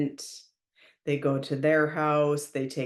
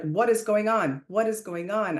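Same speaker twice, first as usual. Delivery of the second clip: re-enacted loud demand, pitched up; 5 semitones higher than usual. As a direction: loudly imitate confronting someone. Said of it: "I kept saying very loudly".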